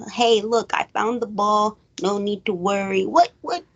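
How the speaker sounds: ordinary delivery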